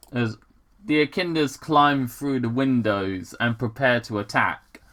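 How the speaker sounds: ordinary talk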